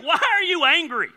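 God rebuking Cain. Retold or imitated. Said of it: "He's like".

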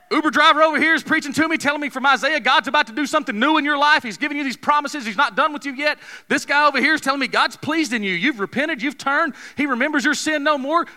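high, fast, fervent preaching; a preacher testifying fervently; preaching at full force. Real, not acted. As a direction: build the fire, preaching fast.

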